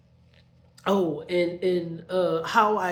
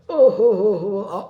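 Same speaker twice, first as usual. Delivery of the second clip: loud mock sobbing oh oh oh; imitating his sobbing; 3 semitones higher, 12 dB louder than usual. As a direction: ham up his crying like a baby. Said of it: "the crying like I'm doing".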